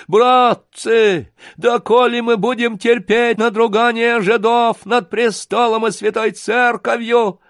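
high, loud wailing harangue; trembling, tearful appeal. Acted. Гундосый to his comrades, borrowed words, tearful harangue. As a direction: declaim learned words in a sustained wail. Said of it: "плачущим голосом чьи-то чужие, заученные слова".

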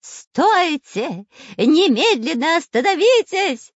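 high, loud, creepy villain yell; menacing high-pitched shouting; ghastly shout. A narrator's reading. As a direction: scream orders like a horrible spy. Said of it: "кричал жутким голосом шпион Дырка".

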